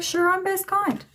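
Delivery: high-pitched put-on voice of a joker; William joking. Medium quoting the joking dead father to his daughter, high-pitched. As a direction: voice his quip well above your own pitch. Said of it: "He's like".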